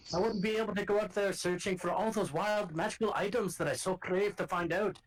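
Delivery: gruff Scottish guard calling out to strangers; rough Scottish accent. Acted. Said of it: "I know somebody who talks like this".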